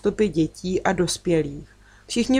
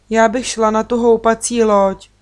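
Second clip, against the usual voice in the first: eager, higher and louder; excited exclaim; 4 semitones higher, 6 dB louder than usual. A narrator's reading. Natálka eagerly picks the swing boat, higher and louder. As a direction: burst out with delight. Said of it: "vykřikla nadšeně Natálka".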